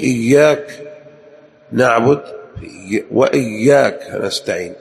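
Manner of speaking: إياك with a held, doubled ي; geminated ya in both إياك words. Shown as a demonstration. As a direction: press on the ya in وإياك. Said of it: "ويشدد في".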